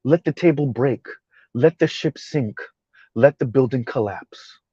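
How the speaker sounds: softly measured, spaced-out mock composure; mock calm tone. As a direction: imitate someone calm, line by careful line. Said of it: "they speak like this".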